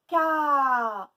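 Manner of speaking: CA loud with the a held long; stretched syllable. Shown as a demonstration. Teacher letting pupils hear the A, stretched syllable. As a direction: stretch Ca into caaa. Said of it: "on entend A".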